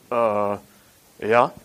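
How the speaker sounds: low, reluctant mumbled agreement; sheepish worker. Retold or imitated. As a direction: grudgingly admit it under your breath. Worker answering the landowner.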